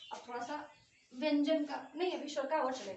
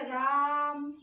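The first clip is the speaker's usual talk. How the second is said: राम with the आ held very long and louder; prolonged vowel; 7 dB louder, much slower than usual. Shown as a demonstration. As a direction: stretch राम out as if calling across a field. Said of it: "प्लुत स्वर में क्या जाएगा". (राम को खूब लंबा खींचकर, ज़ोर से पुकारते हुए)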